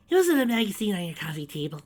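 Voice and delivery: high, loud bubbly answer; tossing it off gleefully; amused. A narrator's reading. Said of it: "sounding amused".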